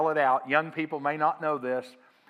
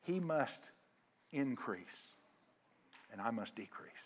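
hushed, with long pauses, sinking lower; humble surrender; 3 semitones lower, 10 dB quieter than usual. Acted. John yielding his ministry to Jesus, hushed humble surrender. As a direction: let it fall away softly, phrase by phrase.

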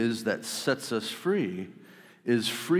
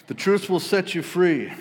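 higher and louder, ringing out the saying; emphatic proclamation; 8 semitones higher, 7 dB louder than usual. Shown as a demonstration. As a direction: proclaim it like a banner over the room.

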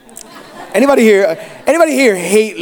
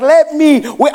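mock-angry child, higher pitch; imitated angry nephew; 6 semitones higher, slower than usual. Angry nephew demands that uncle give in, imitated anger. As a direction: act out a boy's cross demand. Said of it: "he was so angry".

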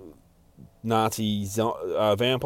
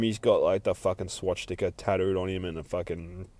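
normal talking voice

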